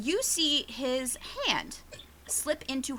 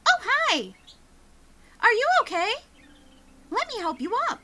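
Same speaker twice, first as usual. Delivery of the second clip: put-on high, eager little boy; sugary child, overly helpful; sweet kid voice; 10 semitones higher than usual. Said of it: "He's like".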